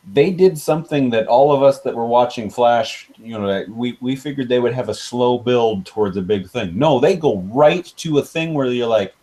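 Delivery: normal talking voice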